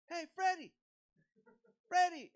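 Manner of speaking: loud, high call across a crowd; yelling. Retold or imitated. Fan calling to the singer in a crowd, yelling. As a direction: holler the name to get attention. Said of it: "like, yelling".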